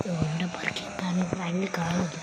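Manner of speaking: sneaky hushed aside to the viewers; whispering so Franklin won't notice; secret whisper. Pretend. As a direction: whisper ഇവിടെ park ചെയ്യാം, voice off. Hiding from Franklin.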